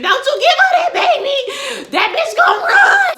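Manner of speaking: high laughing shout of warning; screaming at the TV. Retold or imitated. Viewer yelling at the screen not to hand over the baby, laughing shout. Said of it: "I'm screaming".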